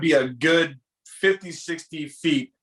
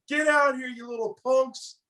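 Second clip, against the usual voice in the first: half-volume imitation of an angry holler; retold angry yell; 9 semitones higher than usual. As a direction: imitate a man chasing off kids. Man yelling at fleeing boys, retold.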